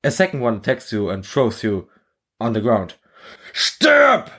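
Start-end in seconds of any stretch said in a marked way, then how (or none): 3.5-4.3 much higher, louder sharp cry; scream. Acted. German soldier screaming at the recruit, desperate scream. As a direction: scream it at your attacker. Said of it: "screams the German at you".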